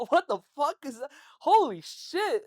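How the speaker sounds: loud, high, laughing disbelief; shocked outburst. Retold or imitated. Reacting to coworker's wild story, shocked outburst. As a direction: blurt it out stunned, voice jumping up.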